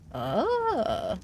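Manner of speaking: normal talking voice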